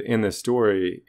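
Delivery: ordinary talk, nothing marked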